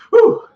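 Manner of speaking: a sharp high nervous blow of breath; nervous whew. Acted. Bracing for a scary video, nervous high whew. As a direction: puff out the tension, high and loud.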